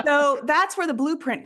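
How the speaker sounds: talking through leftover laughter; laughing. Real, not acted. Picking up her point after the host's joke, still laughing. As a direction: speak while still chuckling.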